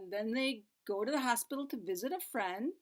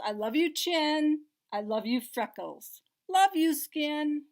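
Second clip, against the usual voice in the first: put-on reading to children; 4 semitones higher, 8 dB louder than usual. Reads rhyming picture book.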